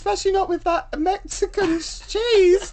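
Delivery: high put-on woman's voice, laughing; Wendy character voice. Wendy reporting the branch closure in high put-on voice. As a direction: keep it high and chirpy.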